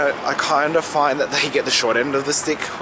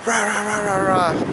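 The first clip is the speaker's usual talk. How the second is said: high nagging rah rah; mock nagging gibberish; mock yelling wife; 8 semitones higher than usual. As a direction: imitate a scolding wife's tirade. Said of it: "the wives would always just yell".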